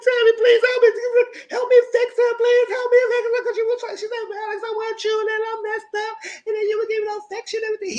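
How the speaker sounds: whiny begging impression; mock pleading Lenice. Lenice begging Tammy for help, in a high whiny put-on voice. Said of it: "She's like".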